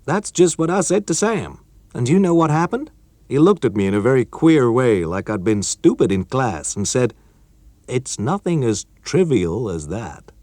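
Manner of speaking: put-on keen young colleague breaking news; character voice of Chuck, eager; Chuck, young American engineer. Chuck's voice in story.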